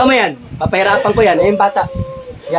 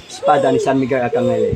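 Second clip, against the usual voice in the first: much lower, measured incantation; solemn invocation; 10 semitones lower, 4 dB quieter than usual. Real, not acted. Healer calling on the archangel's sword, solemn invocation. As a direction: invoke the saint in a low voice. Healer invoking a saint's weapon.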